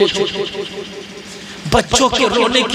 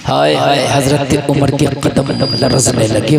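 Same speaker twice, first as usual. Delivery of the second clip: much lower, anguished हाय हाय; grieving lament; 8 semitones lower than usual. Acted. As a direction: tremble with dismay. Umar trembles in shock. (दुखी विलाप)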